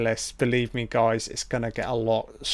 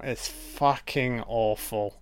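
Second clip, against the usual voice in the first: normal talking voice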